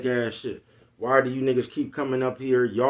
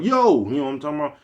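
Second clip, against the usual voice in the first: a sharp, high, loud New York "Yo"; 3 semitones higher, 4 dB louder, faster than usual. Retold or imitated. Mocking a friend back from New York with a sharp New York "Yo". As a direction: bark "Yo" in a put-on New York accent. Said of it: "come back talking with an accent".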